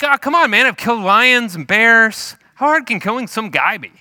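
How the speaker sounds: put-on high, loud, scoffing teenager; cocky David protesting. David arguing with Saul about killing Goliath, cocky and loud. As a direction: protest loudly with brash confidence. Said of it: "And David's like".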